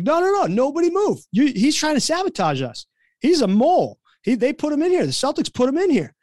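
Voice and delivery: high-pitched, insistent imitation of an accuser; retold mock accusation. Player telling team the coach is a mole, mock-outraged. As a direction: expose the traitor, hammed-up and high.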